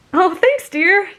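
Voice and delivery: high, loud, cheerful reply; bright thanks. A narrator's reading. Girl thanks her friend for the compliment, bright. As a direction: thank her with a big smile. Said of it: "you smiled brightly".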